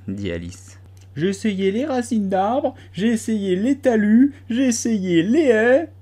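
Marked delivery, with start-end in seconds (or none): 1.2-5.9 high, slow plaintive bird voice; complaining pigeon. A narrator's reading. Pigeon ignoring Alice, high plaintive litany. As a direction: list the failures in a plaintive wail. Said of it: "continua le pigeon".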